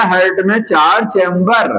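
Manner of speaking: usual speaking voice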